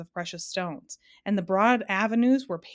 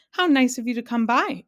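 delighted, louder greeting; exclaiming; 5 semitones higher, 6 dB louder than usual. Acted. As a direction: gush a warm welcome. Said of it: "exclaimed the man".

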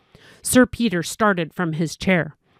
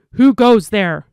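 sharp guard's challenge called out loud; shouted challenge; 2 semitones higher, 8 dB louder than usual. A narrator's reading. Gatekeeper challenging a figure in the fog, loud shout. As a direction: bark it into the fog like a sentry. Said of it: "voice shouted the challenge".

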